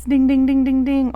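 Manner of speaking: high sung bell dings; playful high chime. Pretend. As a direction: ring it like a game show bell. Game show bell.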